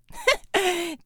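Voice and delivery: short harsh Russian laugh. Acted. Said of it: "There was a harsh laugh".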